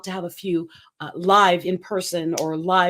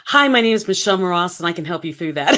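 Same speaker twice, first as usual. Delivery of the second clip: mock sales pitch; 3 semitones lower, 7 dB louder, faster than usual.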